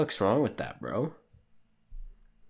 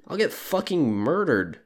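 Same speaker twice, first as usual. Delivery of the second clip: ordinary delivery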